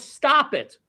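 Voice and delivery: mild mock yell, pitched high; retold yelling. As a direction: imitate an angry man ordering someone off. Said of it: "'cause he was yelling".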